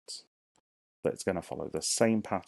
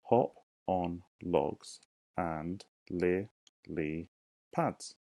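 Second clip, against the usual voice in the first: each syllable said slowly and apart; slow syllable count; much slower than usual. Shown as a demonstration. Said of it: "Right here".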